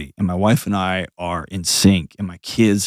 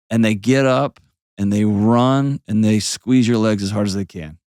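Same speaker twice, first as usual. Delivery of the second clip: ordinary delivery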